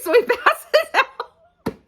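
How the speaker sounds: can barely get it out for laughing. Real, not acted. Retelling the allergic kiss to viewers, laughing.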